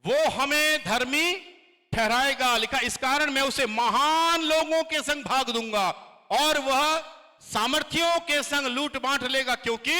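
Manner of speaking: high, loud, proclaiming reading; loud scripture reading. Acted. Pastor reads Isaiah aloud to congregation, loud and proclaiming. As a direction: declaim the verse to the hall.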